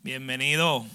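a light attempt at a Puerto Rican accent. Preacher greeting Puerto Rican listeners, light Puerto Rican accent. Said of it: "That's my best Puerto Rican accent".

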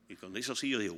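ordinary talk

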